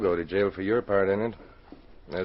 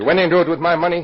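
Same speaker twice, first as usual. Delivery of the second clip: louder, faster, higher, stunned and accusing; angry outburst; 6 semitones higher, 9 dB louder, faster than usual. Acted. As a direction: snap it out like you've just caught him cheating. Partner suspects he was cheated.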